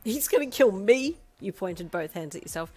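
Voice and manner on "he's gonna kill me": high, loud giddy panic; panicked wail. Acted. Panicking to Sedano about Darby, loud excited wail. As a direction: rant it with thrilled alarm.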